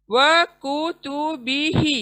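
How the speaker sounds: वकुतुबिही with only ही long, the rest quick; slow tajweed reading of वकुतुबिही; drawn-out recitation. Shown as a demonstration. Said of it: "सिर्फ एक ही खींचना है".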